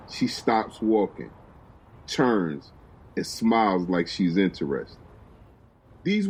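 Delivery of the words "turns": usual speaking voice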